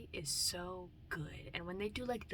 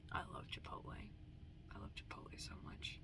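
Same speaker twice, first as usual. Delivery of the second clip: whisper; 11 semitones lower, 13 dB quieter than usual. Real, not acted. Whispering love for Chipotle.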